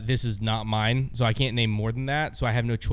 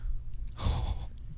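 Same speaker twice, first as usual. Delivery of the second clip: shocked gasp; 10 dB quieter than usual. Real, not acted. Hears a big bid.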